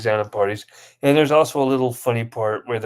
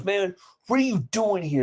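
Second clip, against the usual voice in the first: raised-pitch challenge, acted out; mock gangster confrontation; 6 semitones higher than usual. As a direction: play an affronted gangster in a retelling. Gangster confronts rival in skit.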